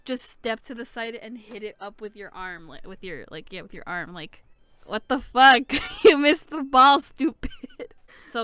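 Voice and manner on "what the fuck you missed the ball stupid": loud, raised-pitch comic outrage; half-laughing angry outburst; mock exasperated scolding. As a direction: yell at the player in disbelief. Mock scolding Hinata for missing.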